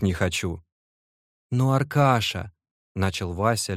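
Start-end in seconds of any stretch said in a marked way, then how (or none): 1.5-2.5 "Аркаша" drawn out slow and higher, with strained gravity; trying to sound important. Acted. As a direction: put on solemn importance, slowly. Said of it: "стараясь всеми силами придать как можно более важности". (Вася важно и медленно тянет «Аркаша»)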